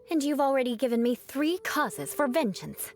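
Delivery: ordinary talk, nothing marked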